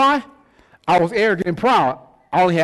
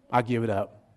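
much softer, lower resigned retelling; quiet surrender; 9 semitones lower, 8 dB quieter than usual. Retold or imitated. Man finally gives up football to God, quiet surrender. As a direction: let it sink down softly in surrender. Giving in to God, last repeat.

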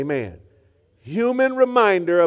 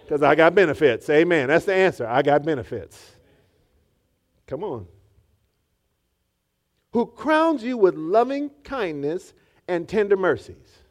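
normal talking voice

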